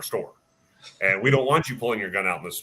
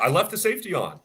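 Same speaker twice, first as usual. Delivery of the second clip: higher, earnest mock voice; dead serious member; 8 semitones higher than usual. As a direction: repeat it very seriously, pitched up. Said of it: "then he's really serious".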